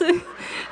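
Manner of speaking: breathy high giggle; giggle burst. Real, not acted. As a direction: laugh at herself. Laughing burst.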